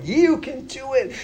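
high, bright cheer of little flowers urging you on. Pretend. Flowers cheering hikers on the trail, high and encouraging.

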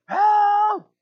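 high, loud, drawn-out yell; mock cry for help. Three swimmers caught in the riptide, high mock yell. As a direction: act out a squealing call for rescue.

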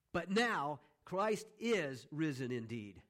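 NOW and IS punched, drawn out slowly; emphatic. Real, not acted. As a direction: declare it slowly, leaning hard on "now" and "is". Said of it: "why I put that, that emphasis there".

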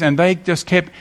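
ordinary talk, nothing marked

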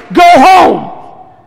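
loud, high, angry bark of a doctor; mad doctor's shout. Retold or imitated. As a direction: yell it like a baffled, angry doctor. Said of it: "And that's the way he said it".

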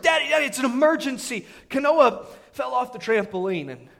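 high, loud mimicry of a small boy; mock-frantic little kid voice; imitating excited child. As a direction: act out a kid rushing in with urgent news. Child's urgent report, retold.

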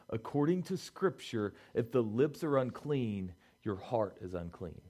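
slow, quiet and deliberate so they can write it down; said slowly. Shown as a demonstration. Pastor slowly dictating a key verse to the congregation, quiet and deliberate. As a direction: spell it out slowly, word by word. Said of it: "I'm gonna say this slow".

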